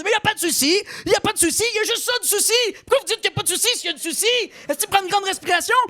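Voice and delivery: high-pitched, fast, frantic rant; exasperated rant. Acted. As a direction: lose it over the phrase. Arguing vendor and customer.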